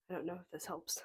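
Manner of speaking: ordinary talk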